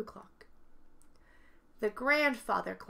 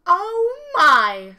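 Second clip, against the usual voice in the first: a loud shocked exclamation; loud dismayed oh my; 8 semitones higher, 19 dB louder than usual. Acted. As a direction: blurt it out, startled. Glum bursts in at noise.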